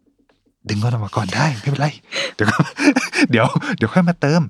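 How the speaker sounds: slow, sly tempting voice; whispering in your ear. Acted. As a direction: coax with a giggle. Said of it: "อย่าให้มีใครมากระซิบข้างหูคุณว่า".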